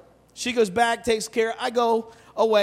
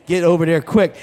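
much lower, pushed louder, urgent manager warning staff; 9 semitones lower, 4 dB louder than usual. Retold or imitated. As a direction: hiss out the alarm like a nervous manager. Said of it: "Manager's like".

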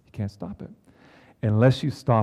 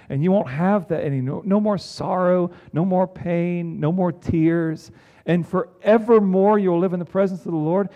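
soaring preaching; 6 semitones higher, 5 dB louder than usual. Acted. Preaching heaven's promise.

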